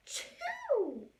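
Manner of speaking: huge high loud achoo; wolf sneezes the stick house down; big sneeze. Acted. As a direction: let a giant sneeze explode. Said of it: "I sneezed a great sneeze".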